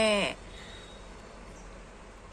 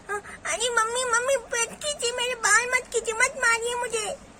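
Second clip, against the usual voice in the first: put-on high, wailing child's voice; pleading little girl; 9 semitones higher than usual. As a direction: beg mother tearfully not to hit. Child begs as hair pulled. (रोते हुए गिड़गिड़ाती बच्ची)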